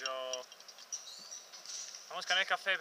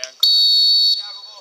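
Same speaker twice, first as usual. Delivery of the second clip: squealed call; 15 dB louder than usual. Acted. Commentary, save call.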